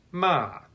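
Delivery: ma falling sharply from high; fourth tone ma. Shown as a demonstration. Teacher shows the fourth tone, falling. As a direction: drop ma hard like a command. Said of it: "fourth tone is falling and sounds like".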